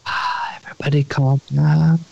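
breathy soothing ASMR whisper. Retold or imitated. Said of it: "They're telling me about how".

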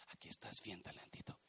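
whispered lovingly to the coin. Acted. Servant whispers to his hidden talent, tender whisper. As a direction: breathe it to the talentito as if hiding it.

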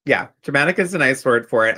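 normal talking voice

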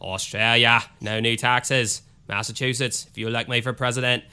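lightly voiced JFK Boston accent; JFK impression. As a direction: try an Australian accent that turns into a JFK Boston accent. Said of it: "I always sound like J F K".

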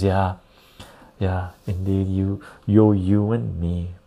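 ordinary talk, nothing marked

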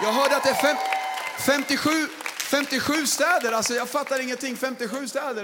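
loud, high amazed announcement; can't believe how many cities came; thrilled crowd-pumping yell; excited shout. Real, not acted.

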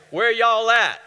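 high mock Southern "Where y'all at"; proper Southern. Preacher giving God's proper Southern phrasing, mock Southern. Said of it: "the proper Southern, God would say".

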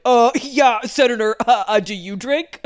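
loud, halting mock testimony; Brett Kavanaugh. Nominee turns a senator's question back on them, mock blustering impression. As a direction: stammer defensively back at a senator. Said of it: "the Brett Kavanaugh voice, which is like".

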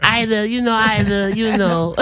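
high voice, laughing; lisping smooth talker; Bob Sumner impression. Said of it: "We're doing a Bob Sumner off".

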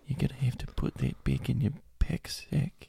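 soft, hushed Australian librarian; subdued Australian accent. Acted. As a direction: shush a patron in a quiet Aussie accent. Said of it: "a more subdued Australian".

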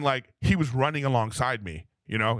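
ordinary talk

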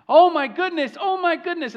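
high, loud "oh my goodness" cried out in wonder; retold shout. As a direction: shout it like the weatherman overcome on live TV. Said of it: "he starts to just shout".

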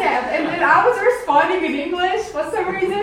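loud, high, laughing delivery; laughing while talking. Real, not acted. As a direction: talk through a burst of laughter. Amused by her own dream.

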